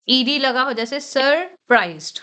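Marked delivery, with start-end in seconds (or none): none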